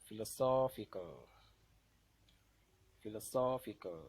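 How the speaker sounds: teacher's demo of philo-SOPH-ical; stressed syllable. Shown as a demonstration. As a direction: hit SOPH hard in Philosophical, then fall away. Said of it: "the one that will be pronounced louder".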